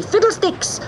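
Fiddlesticks! cried out high and sharp; shouting. Acted. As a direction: yell Fiddlesticks! as you pounce. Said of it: "shouting loudly".

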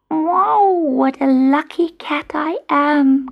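high, thrilled little kitten voice; delighted kitten. A narrator's reading. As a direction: kitten glowing with luck. Kitten thinks happily.

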